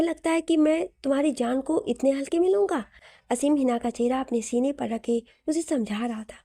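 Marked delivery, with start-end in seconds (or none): none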